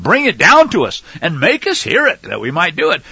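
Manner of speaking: high, loud mock questions; mock those who wait for someone to fetch it; sarcastic scripture reading. Preacher voicing the doubters' questions, mocking.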